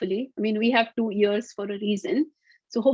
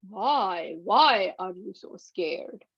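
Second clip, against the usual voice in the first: a big lion asking gently, drawn out slowly; 3 semitones lower, slower than usual. Acted. Storybook lion questions the scared mouse, slow lightly deepened voice. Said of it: "Lion had a deep, loud voice".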